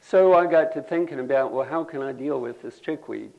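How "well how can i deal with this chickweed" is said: usual speaking voice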